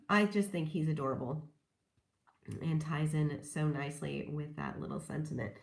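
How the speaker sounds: usual speaking voice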